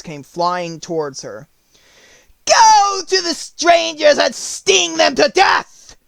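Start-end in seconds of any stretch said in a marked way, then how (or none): 2.4-6.0 high, slow, shouted witch command. Acted. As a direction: bellow the order like a witch. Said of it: "commanded the witch".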